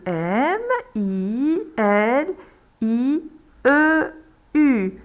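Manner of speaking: "M I L" drawn out letter by letter; slow spelling. Shown as a demonstration. Teacher dictating letters of milieu, slowly. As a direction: spell slowly while writing.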